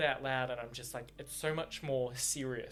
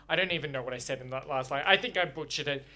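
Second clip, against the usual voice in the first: usual speaking voice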